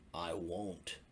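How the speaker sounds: a lightly voiced stubborn bear; old bear grumbling back at the cub; grumpy old bear.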